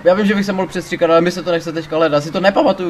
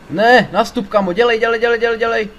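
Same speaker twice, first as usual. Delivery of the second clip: higher, louder urging, over and over; urgent hurry up; 7 semitones higher, 4 dB louder than usual. Real, not acted. Gamer urging his buddy into the car, frantic. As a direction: push him into the car fast.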